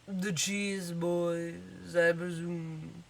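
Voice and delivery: soft, slow, formal butler voice; classic butler. Acted. Gnome butler greeting the cheese boys, slow formal voice. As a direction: play a stiff butler, hushed and drawn out. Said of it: "classic butler".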